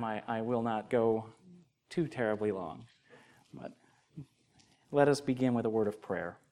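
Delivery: ordinary talk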